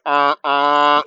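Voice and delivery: loud, held truck honk noise; imitated truck horn. Semi-truck warning the swerving dad, imitated horn. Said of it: "a semi-truck at one point went like".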